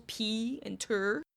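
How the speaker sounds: "P and ter" split slowly into two separate beats; two syllables. Retold or imitated. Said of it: "which she emphasized as two syllables".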